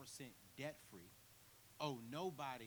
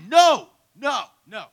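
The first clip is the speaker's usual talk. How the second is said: frantic high screaming no; panicked no; 9 semitones higher, 31 dB louder, much slower than usual. Retold or imitated. Naked son sees police coming, terrified scream. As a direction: scream it in terror. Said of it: "I'm sitting there tripping like".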